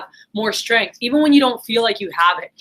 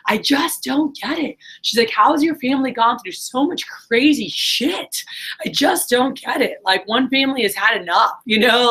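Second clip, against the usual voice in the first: higher, imitating a sobbing, bewildered sister; mild mock of a grieving sister's wail; retold bawling; 6 semitones higher than usual. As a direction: echo her disbelief in a raised, pleading voice. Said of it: "she was bawling, yelling".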